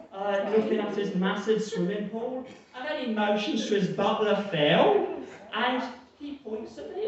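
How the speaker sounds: normal talking voice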